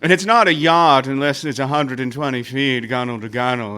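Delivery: slowed, languid upper-class Boston drawl; slow Boston Brahmin. Retold or imitated. Friend mocking snobbish Boston elites, slow lazy drawl. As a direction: drawl it like you can't be bothered. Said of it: "to have that same accent, but slow it down".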